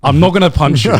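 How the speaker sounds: laughing through a mock-zen reply. Telling the neighbor he won't punch him, laughing. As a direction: giggle while claiming to be calm.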